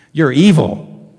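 loud slow punch on evil; dramatic reveal. Acted. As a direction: drop it like a bombshell. Punchline of Jesus' quoted turn.

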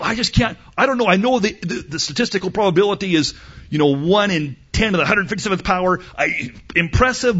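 high, rushed, stammering excuses; flustered waverer. Acted. Voicing listener who cannot decide.

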